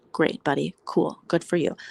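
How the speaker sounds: ordinary talk, nothing marked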